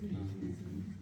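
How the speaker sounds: 리즈닝 said quietly, English-style; English pronunciation. Shown as a demonstration. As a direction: model 리즈닝 softly. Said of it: "리즈닝이라고 하는 거예요". (리즈닝 발음 시범)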